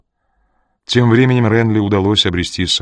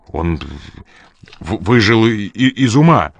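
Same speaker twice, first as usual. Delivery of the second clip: halting, stuttering, rising in pitch; stunned stammer. A narrator's reading. Ян excusing himself about the old man, stammering. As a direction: stumble over the words in shock. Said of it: "ошеломлённо пробормотал Ян".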